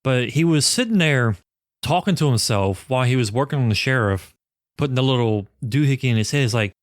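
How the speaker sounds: ordinary delivery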